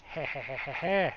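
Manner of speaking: villain-style snicker, pleased; cartoon laugh. Real, not acted. Giggles at finding easy building.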